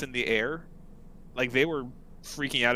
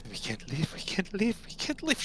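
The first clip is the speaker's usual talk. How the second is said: each repeat climbing higher and more frantic; panicked halfling; 7 semitones higher, 4 dB quieter than usual. Acted. Halfling begging the bandits, voice rising in panic.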